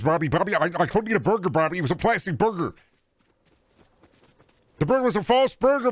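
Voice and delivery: a panicked high-pitched character pleading with Bobby; Deca voice. Acted. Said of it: "Deca voice is the real best voice".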